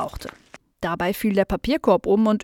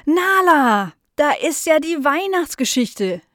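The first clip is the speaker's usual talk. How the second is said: high, loud astonished exclamation; delighted surprise; 7 semitones higher, 4 dB louder, slower than usual. Acted. Tante Miriam recognises the lost story, delighted surprise. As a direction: cry out the name in happy amazement.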